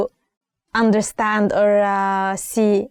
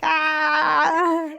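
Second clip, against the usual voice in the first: a short higher mock yell; mock angry shout; 6 semitones higher than usual. Teacher imitates yelling at her sister, mock shout. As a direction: yelp like fighting with a sister. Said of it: "I was like".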